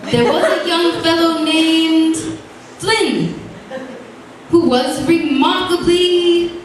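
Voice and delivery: slow, lilting limerick recital; sing-song verse read aloud; lightly performed poem; reciting a limerick. A narrator's reading.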